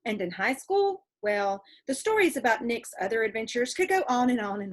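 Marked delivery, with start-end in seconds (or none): none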